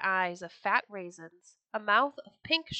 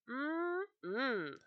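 soft, high "mm-mm"; hungry cow's savouring hum; appreciative sniffing hum; 5 semitones higher, 5 dB quieter than usual. A narrator's reading. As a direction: hum as if smelling something tasty. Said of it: "sniffed the cow".

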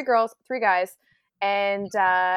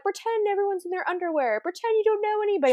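mocking advice; 12 semitones higher than usual. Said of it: "the whole thing of".

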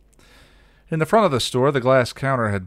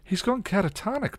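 high, anxious explaining; uneasy, high-pitched report; worried. A narrator's reading. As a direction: explain it with worry in the voice. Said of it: "Willow explained worriedly".

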